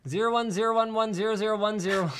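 lightly voiced robot reading binary; mock robot rattling off digits; robot saying binary. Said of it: "We're robots".

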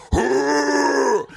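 high mock bear growl; mock bear grunt; bear growl imitation. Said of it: "bear going".

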